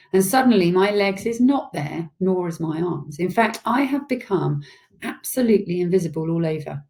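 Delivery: ordinary delivery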